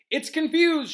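high, loud call; teacher's shout. A narrator's reading. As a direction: shout it over the commotion. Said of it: "shouted Lupin".

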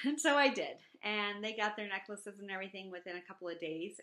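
ordinary talk

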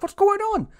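recapping a confusing scene cut with a high, baffled mock cry; mock bewilderment. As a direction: squeal it out like the cut caught you off guard.

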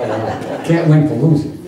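normal talking voice

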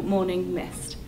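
plain reading voice

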